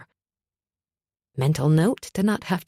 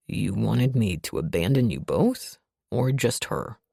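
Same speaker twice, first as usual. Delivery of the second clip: deep, groggy man's voice; gruff brooding man; low male voice; 7 semitones lower than usual. A narrator's reading. Narrator voices Revan.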